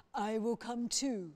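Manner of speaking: loud, ponderous dragon voice; slow and solemn, like a dragon; dragon, earnest and loud; Sandra the dragon. A narrator's reading.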